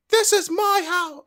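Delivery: slow, loud, very high British lady voice; badly faked British accent, drawn out and shouted high; Paige with British accent. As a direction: holler it as a hammed-up British woman. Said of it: "let me try Paige again".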